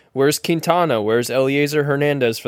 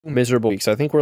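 normal talking voice

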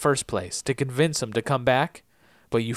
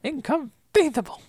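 Vizzini impression; 12 semitones higher than usual. Echoing the movie line.

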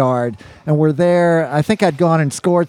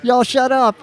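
mock yell, pitched well up; higher-pitched mild mock holler; retold yell; 8 semitones higher than usual. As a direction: imitate someone screaming back, without full volume. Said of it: "starts screaming back at Gate".

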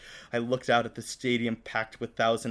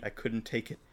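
ordinary delivery

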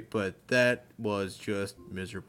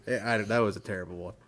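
ordinary talk

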